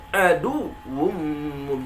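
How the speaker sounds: "aduwwum" slow, lifted on the waw; stressed doubled waw. Shown as a demonstration. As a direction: press the w in "aduwwum". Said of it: "jangan datar". ("aduwwum" dengan waw ditekan)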